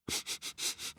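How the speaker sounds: quick sniffs at the air; little animal sniffs; sniffing. A narrator's reading. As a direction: sniff around like an animal catching a scent. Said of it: "he stopped and he went".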